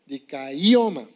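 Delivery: "Dikaioma" slowly, with a drawn-out, stressed I; acento en la I. Shown as a demonstration. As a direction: stretch "Dikaioma" and lean on the I before "oma". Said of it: "con acento en la I". ("Dikaioma" despacio, con acento marcado en la i)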